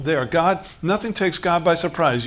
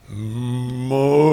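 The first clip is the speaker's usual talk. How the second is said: lower, drawn-out, hammed-up divine call; dramatic voice of God; 4 semitones lower than usual. Shown as a demonstration. Preacher mocking a grand God voice calling Moses, drawn out and lower. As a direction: stretch the name out like a movie God calling from the bush. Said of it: "No, that's not the way he sounded".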